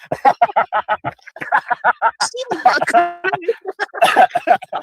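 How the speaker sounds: long, high-pitched cackle of a laugh; high cackling laugh. Real, not acted. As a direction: laugh hard and high at him.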